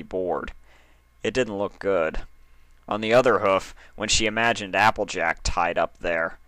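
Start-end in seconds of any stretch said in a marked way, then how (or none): none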